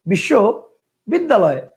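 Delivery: বিশ্ববিদ্যালয় split as বিশ্ব, বিদ্যালয়; one pause inside বিশ্ববিদ্যালয়, after বিশ্ব; two separate chunks. Shown as a demonstration. Teacher shows students another pronunciation, বিশ্ববিদ্যালয় in two separated halves. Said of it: "কেউ উচ্চারণ করতো".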